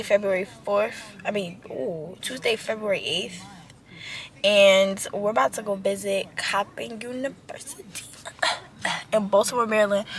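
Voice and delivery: hushed whisper to camera; quiet whisper so nobody hears. Real, not acted. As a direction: breathe it out unvoiced.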